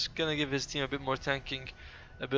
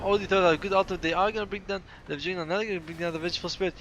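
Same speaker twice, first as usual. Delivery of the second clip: normal talking voice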